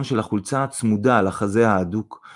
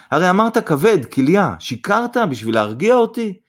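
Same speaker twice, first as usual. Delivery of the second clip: high, raised, alarmed questioning; exclaiming; 8 semitones higher, 3 dB louder than usual. A narrator's reading. Mother challenges son about his illness, exclaiming. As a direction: cry out in disbelief. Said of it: "קרא זינה".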